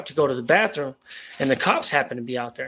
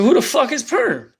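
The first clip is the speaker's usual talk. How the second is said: high, louder, disbelieving who-the-hell; incredulous cop; 10 semitones higher, 4 dB louder than usual. Retold or imitated. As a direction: blurt it out in total disbelief. Cops hearing an unknown name.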